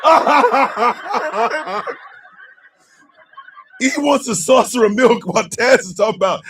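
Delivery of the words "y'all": words dissolving into laughter; real helpless laughter; cracking up. Real, not acted. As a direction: laugh right through the word, higher. Host cracking up at chat jokes.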